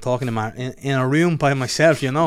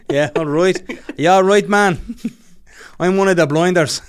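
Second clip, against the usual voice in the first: loud, higher, badly faked Birmingham accent; terrible Brummie; 6 semitones higher, 7 dB louder than usual. Shown as a demonstration. Showing a terrible Brummie, loud Birmingham accent. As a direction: announce yourself in a hammed-up Brummie. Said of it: "What's your Bromley like?".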